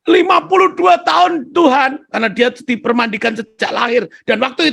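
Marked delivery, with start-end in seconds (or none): 0.1-2.1 loud eager answer. Retold or imitated.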